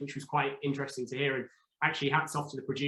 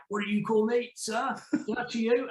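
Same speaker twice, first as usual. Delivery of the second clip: a mock stern copper, pitched up high; stern detective; 8 semitones higher than usual. Detective scolding the contestant for forgetting sir, mock stern and high. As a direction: bark the correction like a strict cop.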